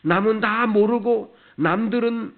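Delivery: high, loud exhortation from the pulpit; impassioned preaching. Real, not acted. Preacher builds to forceful exhortation. (높고 힘찬 목소리로 열띤 설교)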